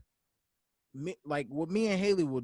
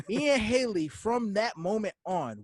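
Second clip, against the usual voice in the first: ordinary talk, nothing marked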